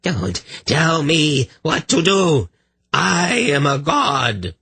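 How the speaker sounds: insane rage. Acted. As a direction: spit it out slow like a madman. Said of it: "twisted into an insane grimace".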